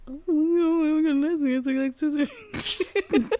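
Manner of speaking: high, loud teasing imitation; changed voice. Teasing a friend about the way she said it, mimicking. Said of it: "kinda changed your voice a little bit".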